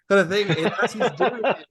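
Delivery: usual speaking voice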